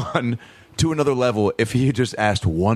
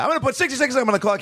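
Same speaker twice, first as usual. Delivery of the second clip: game show host; 11 semitones higher, faster than usual. Retold or imitated. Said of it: "imitating Double Dare host".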